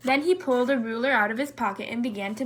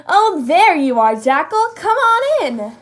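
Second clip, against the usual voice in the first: bright, higher, louder welcome calling a friend in; welcoming call; 5 semitones higher, 8 dB louder than usual. Acted. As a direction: Zebra calling Jackal inside, welcoming and loud. Friendly greeting.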